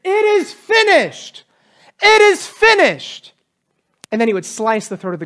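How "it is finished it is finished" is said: high, slow proclaiming cry; drawn-out ritual shout, much higher. Retold or imitated. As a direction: call it out so the whole crowd hears. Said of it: "the priest would cry out so everybody could hear".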